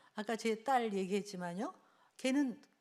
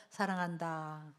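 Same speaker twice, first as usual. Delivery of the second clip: very slow, deliberate phrases for the crowd to echo; slow call-and-repeat blessing; affirmations to repeat; 4 semitones lower, much slower than usual. Shown as a demonstration. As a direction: say each word slowly so they can follow. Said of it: "저를 따라해볼까요?".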